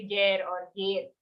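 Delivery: गैर then गेर with a plain "e" for the "ai" glide; गैर flattened toward गेर; common mispronunciation. Shown as a demonstration. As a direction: quickly say गैर or गेर without the gai diphthong. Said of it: "sometimes you say".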